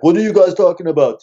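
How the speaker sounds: a touch of the big Russian guy, puzzled at the fuss; big Russian dude. Retold or imitated. Russian hostel guest asking the scared friends what's up, lightly voiced. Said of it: "and he's like".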